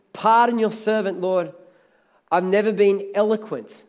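ordinary delivery

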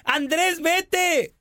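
high-pitched imitated woman; Carola's mock protest. Carola telling Andrés to leave, high mock protest.

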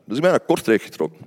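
usual speaking voice

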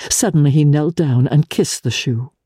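usual speaking voice

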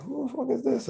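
high, incredulous question; puzzled surprise. Retold or imitated. Rapper getting an unknown LinkedIn message, puzzled surprise. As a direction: sound caught off guard.

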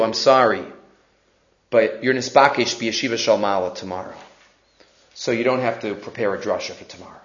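low, soft, solemn delivery; acted somber messenger; grave message. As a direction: break terrible news gently, with pauses. Messenger gives grave death sentence.

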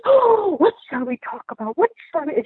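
light high Swedish lilt, imitating; playful imitation of a Swedish woman's voice; Swedishy accent. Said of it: "she has this little Swedishy accent".

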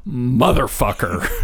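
slow, laughing delivery of the punchline; can barely get the word out for laughing; laughing through it. Retold or imitated. Telling grandma's story, laughing at little Bobby's punchline. Youngest boy's big cuss word.

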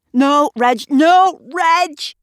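high, loud alarmed yells of no; yelping at a dog that charges in; shouting at the dog. Acted. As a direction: fend off a bounding dog with your voice. Gavin fends off charging dog.

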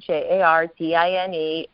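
J A R D I N E, letters one at a time; spelled out. Shown as a demonstration.

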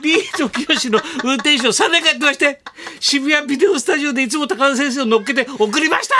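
high-pitched, overwrought mock confession; hammed-up emotional driver voice; confessing taxi driver. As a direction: imitate an awestruck driver revealing a secret.

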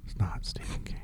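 hushed whisper, no voice. Real, not acted. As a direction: whisper the denial. Same denial, now whispered.

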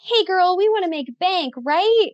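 put-on high, perky Valley Girl read. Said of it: "like, a Valley Girl voice".

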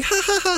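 very high fake giggle; mock laugh. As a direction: put on a squeaky fake laugh. Mocks a reaction he wouldn't have.

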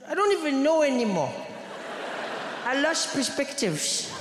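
high, despairing schoolboy friend; Thiessen. Retold or imitated. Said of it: "He said".

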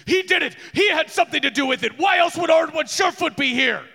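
high, loud furious shouting, fast; frantic accusation. Acted. Father accusing the rival in court, shouting high. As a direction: yell it while being held back. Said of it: "he is freaking out, being held back, going".

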